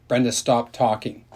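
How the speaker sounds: ordinary delivery, nothing marked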